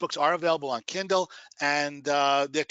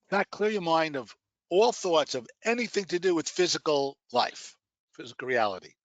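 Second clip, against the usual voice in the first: guided meditation. Acted. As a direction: guide them gently into relaxation.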